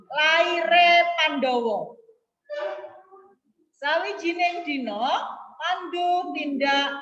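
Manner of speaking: slow, loud, clear model reading; wyraźne czytanie. Shown as a demonstration. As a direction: read it out slowly for the class, clearly. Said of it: "harus jelas ya".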